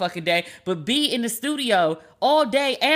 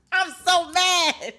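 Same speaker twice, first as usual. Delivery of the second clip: slow, higher, laughing through it; laughing mad; 8 semitones higher, much slower than usual. Real, not acted. Host admitting to listeners she's still mad, laughing. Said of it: "Can you tell I'm still mad".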